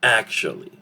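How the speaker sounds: "actually" squeezed to AK-shlee; two-syllable reduced form. Shown as a demonstration. Teacher showing a two-syllable word, "actually" as AK-shlee. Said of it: "now it's a two-syllable word".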